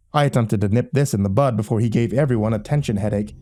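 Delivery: ordinary delivery, nothing marked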